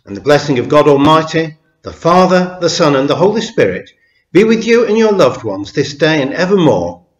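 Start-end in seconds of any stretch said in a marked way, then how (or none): none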